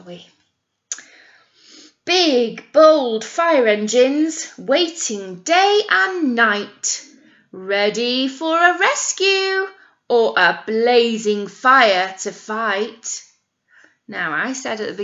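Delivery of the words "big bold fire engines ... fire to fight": slow, bold storybook read-aloud; reading to children. Acted. Teacher reading the fire engine book to children, bold and slow. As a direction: read the rhyme out big and slow.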